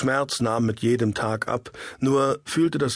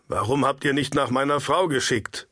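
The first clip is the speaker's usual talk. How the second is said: ordinary delivery, nothing marked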